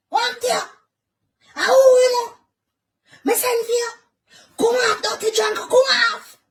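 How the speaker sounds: angry shout. Real, not acted. Neighbor shouting at the dirty drunk to back off, loud and angry.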